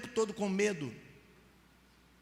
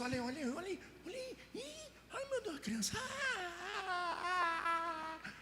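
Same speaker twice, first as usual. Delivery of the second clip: mock alarm rising to a high shrill cry; scaring a child; 12 semitones higher, 5 dB quieter than usual. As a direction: pretend panic to frighten a child, voice leaping high. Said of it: "Você fala pra criança". (imitação de susto fingido, bem agudo)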